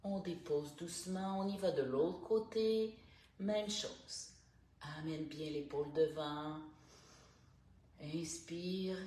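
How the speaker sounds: calm, unhurried instructor guiding each breath; guided stretching voice. Acted. Morning stretch coach guiding listeners through neck and shoulders, calm. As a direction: talk the class softly through every stretch.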